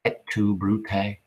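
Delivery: slow, soft and hurt; quiet, slow last words; dying whisper-soft. Acted. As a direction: breathe it out as a betrayed dying man. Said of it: "Caesar says one thing".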